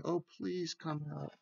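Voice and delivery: understated pleading; begging. A narrator's reading. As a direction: beg gently, as read to children. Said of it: "and he's begging".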